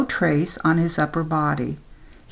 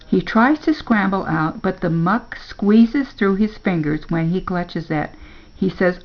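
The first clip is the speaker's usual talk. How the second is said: plain reading voice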